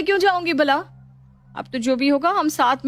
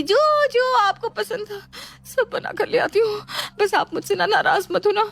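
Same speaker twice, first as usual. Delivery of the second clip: tearful whimper; 4 semitones higher than usual. Acted. Daughter-in-law promises food to the ghost, near tears. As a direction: whimper out of sheer fear.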